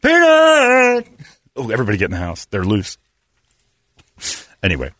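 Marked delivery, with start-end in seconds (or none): 0.0-1.1 high, loud calling; hammed-up frantic call; mock scream. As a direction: yell the name for the lost dog. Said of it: "He's screaming for".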